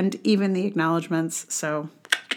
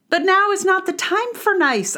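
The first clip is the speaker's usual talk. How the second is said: fierce declaration; 11 semitones higher than usual. Acted.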